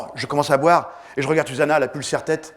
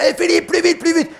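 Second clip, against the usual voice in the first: much higher, loud yell; Jacques yells; 14 semitones higher, 4 dB louder than usual. Retold or imitated. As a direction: hurry the swimmer on. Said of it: "Jacques, il gueule". (Jacques gueule à Philippe d'accélérer, cri aigu)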